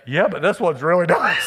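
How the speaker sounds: amused, breaking into a laugh on nice; laughing reply. Retold or imitated. Preacher telling his mother-in-law the new husband is nicer, laughing. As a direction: tease her gently through a laugh. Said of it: "I was like".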